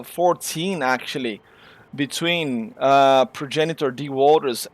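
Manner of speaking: play-by-play of the standings, energy settling; winding-down race call; race commentary. Real, not acted. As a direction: keep calling the results as the adrenaline fades. Calls finishing positions.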